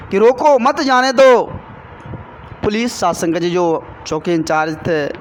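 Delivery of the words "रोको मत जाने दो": slow, louder रोको, then मत जाने दो together; deliberate order, breaking after रोको before मत जाने दो; pause after रोको. Retold or imitated. Senior officer orders police to block road, pause after रोको. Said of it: "उन्होंने कहा".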